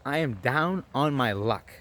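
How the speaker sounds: each word drawn out, "down" stretched; slow, emphatic plea. Retold or imitated. Asking a stranger for change, slow emphatic plea. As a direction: spell out the hardship slowly and deliberately.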